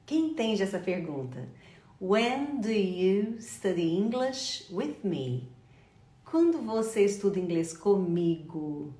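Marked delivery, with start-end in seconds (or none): 2.0-5.5 slow question, word by word; drawn-out, carefully spaced phrasing; slow teaching repeat. Shown as a demonstration. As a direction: spell the question out slowly for learners. Question repeated slowly for learners.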